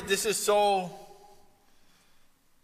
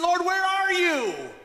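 high, slow, anguished cry to the Lord; crying in desperation; 13 semitones higher, 4 dB louder, slower than usual. Acted. Mary crying out for her Lord in desperation. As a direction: plead as if lost and desperate. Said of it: "she's crying in desperation".

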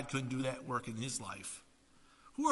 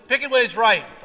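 louder, higher motto; loud slogan; 5 semitones higher, 10 dB louder than usual. Retold or imitated. Preacher quoting a wine slogan, loud proclamation.

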